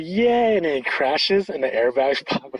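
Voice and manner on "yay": high, loud, delighted whoop; squealed yay of joy; gleeful cheer. Retold or imitated. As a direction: cheer like a kid on a joyride. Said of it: "He's like".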